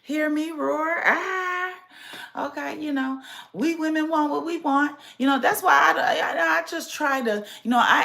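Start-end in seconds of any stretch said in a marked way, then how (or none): 0.0-1.7 slow, playful roar of pride; hammed-up I am woman cry; mock roar. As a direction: roar it out like a proud lioness. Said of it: "I am woman too".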